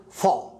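فا said with a rounded "o", like "fo"; rounded vowel. Shown as a demonstration. Teacher showing F with a damma, rounded فا as "fo". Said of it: "يصبح مضموم فينطق المفروض".

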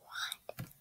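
unvoiced whisper of disbelief; whispered what. Real, not acted. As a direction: breathe it out, stunned. Pet missing from the shop.

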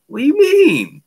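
mock shock at an outrageous offer; incredulous. Retelling his reply to the cop, incredulous high squeal.

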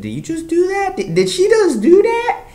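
shrill-voiced god who can't believe it; shocked Beerus. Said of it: "And Beerus was like".

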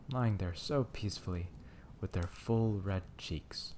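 plain reading voice

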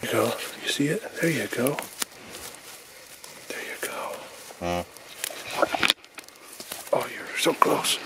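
very quiet, half-whispered encouragement; hushed, real murmur to an animal; soft coaxing. Real, not acted. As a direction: gently urge a newborn animal on, under your breath. Coaxing the newborn calf to suck.

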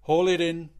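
loud commanding order; barked order. A narrator's reading. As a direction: order it sharply. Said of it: "he ordered the second mate".

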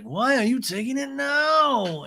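high, slow mock wail; hammed-up drawn-out complaint; Lisa. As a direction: stretch the last word in high exasperation. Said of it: "Lisa's like".

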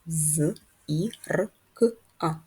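"З, І, Р, К, А" as separate sounds with pauses; segmented З... І... Р... К... А, no letter names. Shown as a demonstration. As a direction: count out З, І, Р, К, А one isolated sound at a time. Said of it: "Скільки звуків має слово зірка".

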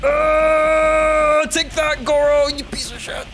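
excited taunt. Real, not acted. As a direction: gloat at the beaten fighter. Gamer trash-talking after win.

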